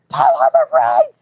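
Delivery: full-force hammed-up yell; imitated screaming. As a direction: scream the order in someone's face. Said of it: "screaming in my face".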